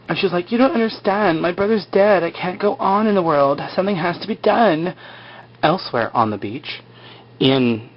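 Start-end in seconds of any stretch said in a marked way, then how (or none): 0.5-4.9 high-pitched pleading imitation; put-on high voice of protest; mock distraught woman. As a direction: imitate a grieving sister insisting, pitched high. Acting out grieving Shannon's protest.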